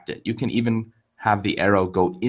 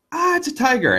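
high startled cry of fright, mock scared; hammed-up yelp of fear; 3 dB louder than usual. Storyteller voicing the frightened hunter, high mock yelp.

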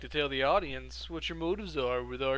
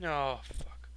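whispering; 6 dB quieter than usual. Real, not acted. Scared reaction in horror game.

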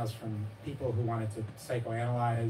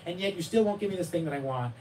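put-on exasperated child; 7 semitones higher, 5 dB louder than usual. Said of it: "He'd be like".

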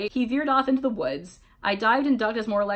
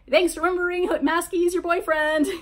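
1 called out; 8 semitones higher, 3 dB louder than usual. A narrator's reading. Yelled teasing reply from crowd.

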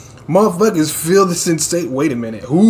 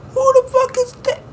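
very high, squeaky disbelief; astonished reaction. Real, not acted. Reacting to a surprise newcomer.

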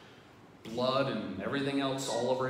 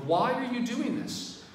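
frustrated cry; 10 semitones higher, 5 dB louder than usual. Retold or imitated. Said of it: "he's so frustrated".

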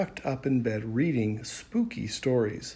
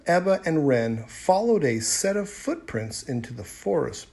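ordinary delivery, nothing marked

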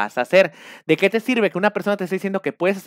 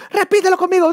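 mock coach yelling; 13 semitones higher, 7 dB louder than usual. Coach yelling at a student to break him down, mock shout. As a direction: bark insults like a cult coach.

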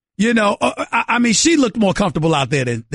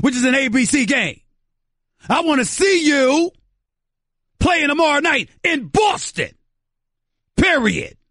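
fired-up rant; 6 semitones higher than usual. Real, not acted. Heated radio rant.

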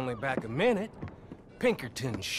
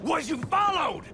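shouted challenge; 12 semitones higher, 5 dB louder than usual. Acted. Micah demanding whether Arthur was followed, shouting. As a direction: bark it across the yard.

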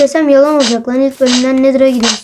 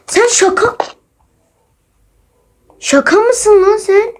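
high, slow, drawn-out disbelief; can't believe what just happened, pitched way up; stunned disbelief; 9 semitones higher, slower than usual. Real, not acted. Shock at game event. (yüksek ve yavaş, şaşkın inanamama)

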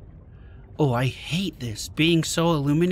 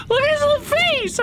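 even higher, louder gushing; overwhelmed by cuteness; 3 dB louder than usual. Real, not acted. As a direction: squeal over the little face. Gushing over creature.

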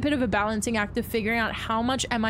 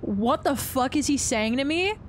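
ordinary delivery

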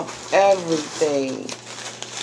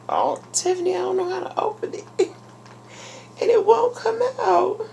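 high-pitched, near-crying whine; overwhelmed and squeaky, half laughing, half crying; tearful helplessness; 14 semitones higher, faster than usual. Real, not acted. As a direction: plead helplessly in a high teary voice. Overwhelmed, struggling to open gift.

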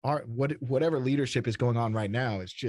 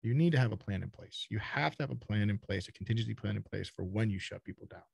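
ordinary talk

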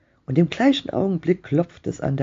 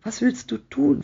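much higher, voiced stage whisper; a suggested urgent whisper, pitched high; strained high question, only hinting at a whisper; hoarse whisper; 12 semitones higher than usual. A narrator's reading. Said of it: "flüsterte er heiser".

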